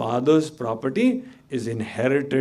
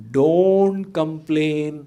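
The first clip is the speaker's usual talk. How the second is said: don't complain, slow and loud, every word hit; stressed don't complain; 3 semitones higher, 5 dB louder, much slower than usual. Acted. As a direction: drive home don't complain slowly. Preacher stresses second condition.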